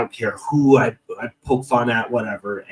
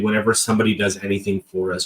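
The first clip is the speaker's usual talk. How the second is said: ordinary talk, nothing marked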